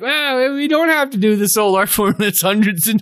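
high-pitched mimicry of dismissive people; mock dismissive. Voices people dismissing the old art form.

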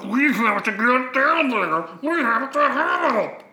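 high, slow, garbled creature voice; squid person. Acted. Squid Jedi urges the others to help, high creature voice. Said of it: "then the squid guy goes".

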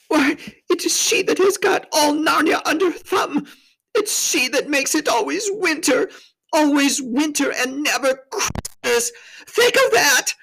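high, tearful lament; hammed-up high-pitched misery; wailing faun. A narrator's reading. Tumnus wails about the Witch.

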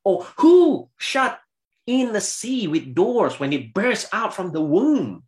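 opens high and loud, then settles; God's challenge. Acted. Preacher voicing God challenging Job, high and loud start. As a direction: thunder the first words like God. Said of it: "verse eight".

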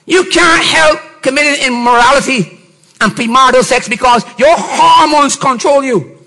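usual speaking voice

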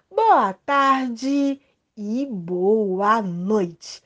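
drawn out sing-song; slow, stretched greeting. Acted. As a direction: stretch each greeting word slowly.

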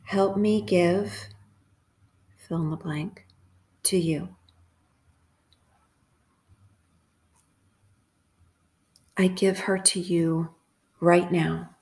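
unhurried prayer in short, separated phrases; calm, measured meditative praying; guided prayer meditation. Acted. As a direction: leave long silences for listeners to repeat each phrase.

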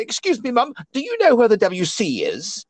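high-pitched put-on British accent; hammed-up British accent asking for directions. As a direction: pose as a polite British tourist. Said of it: "talking with the British accent".

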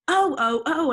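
high, loud hammed-up cries; mock scream. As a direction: overact the scream. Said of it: "I screamed".

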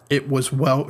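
normal talking voice

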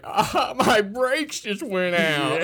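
put-on slow, loud slurred old drunk; town drunk. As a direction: drag the words out like a drunk. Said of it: "stumbling around, like, slurring his words".

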